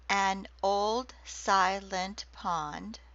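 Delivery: very slow, each syllable set apart; slow syllable-counted reading. Shown as a demonstration. Lecturer showing haiku syllables to class, very slow reading. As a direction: count the syllables out as you say them.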